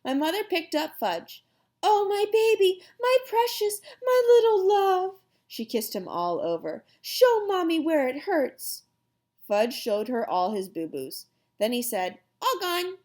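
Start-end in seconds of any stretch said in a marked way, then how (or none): 1.8-5.2 high, loud anguished cooing; distraught doting mother. A narrator's reading. Mother finds injured toddler. 7.0-8.8 higher, slow coaxing; fussing mother. A narrator's reading. Mother comforts hurt toddler. 12.4-13.0 put-on high little-boy voice; toddler announcing. A narrator's reading. Toddler tells mother.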